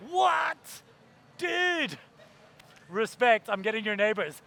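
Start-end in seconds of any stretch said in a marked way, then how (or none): 0.1-2.0 shocked shout. Retold or imitated.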